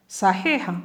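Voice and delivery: ordinary delivery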